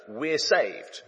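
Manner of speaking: usual speaking voice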